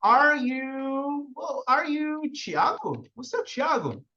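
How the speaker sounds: teacher acting out meeting a student in town, lightly acted; mock street greeting. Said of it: "Eu falo assim".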